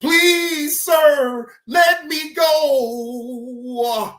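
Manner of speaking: wailing, hammed-up begging with drawn-out words; mock pleading. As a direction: beg melodramatically, imitating a desperate suspect. Host mimics suspect begging police.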